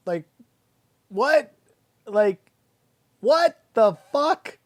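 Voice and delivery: can't believe what he just heard; real disbelief. Real, not acted. Incredulous reaction to dialogue.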